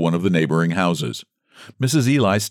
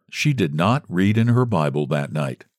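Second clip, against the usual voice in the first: plain reading voice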